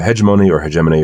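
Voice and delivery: hegemony first as HEJ-uh-moh-nee, then as heh-JEM-uh-nee; two pronunciations. Shown as a demonstration. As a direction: shift the stress of hegemony from first to second syllable on the repeat. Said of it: "however you wanna pronounce it".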